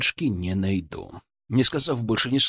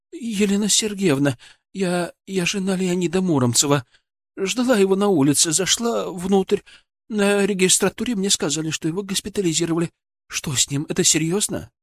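high, hesitant woman's voice; anxious patient's wife; 6 semitones higher than usual. Acted. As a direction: explain yourself to a doctor, high and worried. (встревоженная жена больного, высоким голосом)

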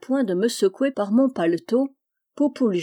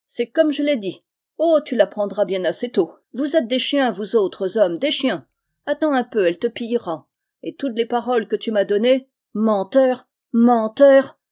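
raised, indignant tirade building to a loud cry; angry accusation; 3 semitones higher, 5 dB louder than usual. Acted. Scorned girl calling her lover a liar, furious. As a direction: hurl the insults, ending loud on the last word.

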